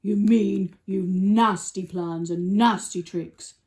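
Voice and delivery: slow, loud elephant voice; Trunky. Acted. Elephant accusing the crocodile, slow and booming. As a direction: stretch it out, big and disapproving. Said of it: "said Trunky".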